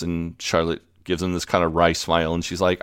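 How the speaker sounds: normal talking voice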